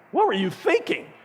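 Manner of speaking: put-on a dad who can't believe what his kid just did; incredulous squeak of a question; exasperated disbelief. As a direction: pitch jumps way up in mock disbelief. Dad reacting to son's antics.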